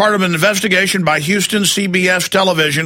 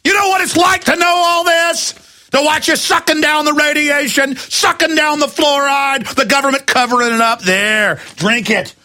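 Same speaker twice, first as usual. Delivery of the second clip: rising angry rant, pitched much higher and shouting; real fury pouring out at listeners; getting mad; 4 semitones higher than usual. Real, not acted. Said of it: "All right, I'm getting mad".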